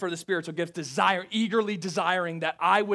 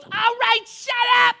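much higher, louder mock mom; mom snapping; 9 dB louder than usual. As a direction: bark it like an impatient East Coast mom. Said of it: "And she goes".

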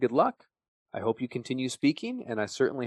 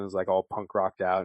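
ordinary talk

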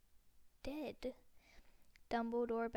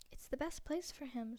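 ordinary delivery

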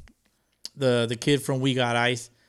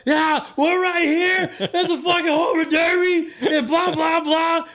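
much higher, loud laughing yell; screaming; 5 dB louder, slower than usual. Retold or imitated. Fans screaming into the video mic at the home run derby. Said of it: "We were screaming at the fucking thing".

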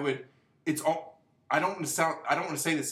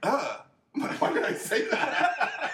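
slow, high, laughing disbelief; laughing self-doubt; 12 semitones higher, much slower than usual. Retold or imitated. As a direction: laugh through the question, very slowly. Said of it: "I'm like".

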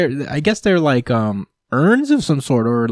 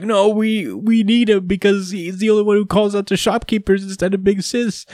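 crying Gory; 10 semitones higher than usual. Retold or imitated. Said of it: "Gory starts to cry".

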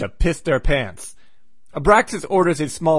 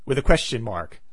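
normal talking voice

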